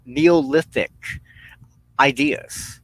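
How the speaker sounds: ordinary delivery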